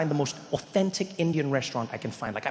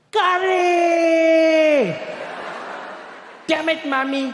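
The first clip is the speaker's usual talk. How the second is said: high, drawn-out exasperated mock scream; top-of-lungs scream; 12 semitones higher, 5 dB louder, much slower than usual. Boy opening his packed lunch, screaming in dismay. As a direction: wail the first word long and high, cursing mom. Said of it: "he'd scream at the top of his lungs".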